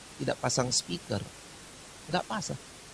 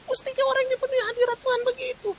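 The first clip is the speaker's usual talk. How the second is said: much higher, fast, marvelling; high awed exclaim; faster than usual. Acted. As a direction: cry it out in wonder. Exclaiming in awe.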